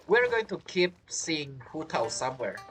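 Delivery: usual speaking voice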